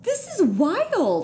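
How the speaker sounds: high, drawn-out amazement; amazed. Real, not acted. Host reacting to guest's story, amazed. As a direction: stretch it out in real astonishment.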